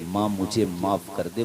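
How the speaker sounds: hushed, humble plea to a mother; soft plea. Acted. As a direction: beg forgiveness quietly. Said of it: "तू खाली इतना बोल दे".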